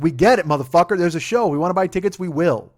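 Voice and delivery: exasperated loud mock audience. Fans mocked, fed up with promotion.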